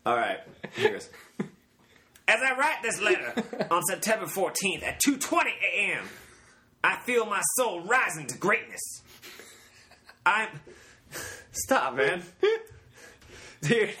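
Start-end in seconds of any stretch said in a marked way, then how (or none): 2.3-9.0 high-pitched, theatrical cult-leader proclamation; Charles Manson impression. Reading a suicide note to a co-host, high Manson impression. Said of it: "this is me doing Charles Manson". 10.2-10.5 high-pitched, theatrical cult-leader proclamation; Charles Manson impression. Reading a suicide note to a co-host, high Manson impression. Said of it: "this is me doing Charles Manson".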